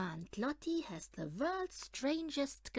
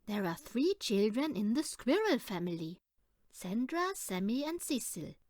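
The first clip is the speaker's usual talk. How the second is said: usual speaking voice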